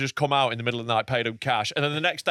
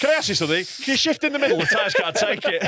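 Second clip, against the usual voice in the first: high-pitched put-on voice; hammed-up plea from a worried driver; mock recovery man; 5 semitones higher than usual. Co-host parodies the recovery man mid-drive, high mock plea. Mocking the recovery man's question.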